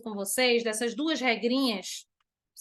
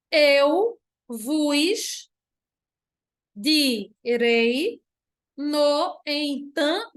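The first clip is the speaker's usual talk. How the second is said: slow, syllable-by-syllable verse reading; leitura silabada; 4 dB louder, much slower than usual. Shown as a demonstration. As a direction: stretch each syllable out for counting. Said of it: "dez sílabas poéticas". (leitura pausada, sílaba por sílaba)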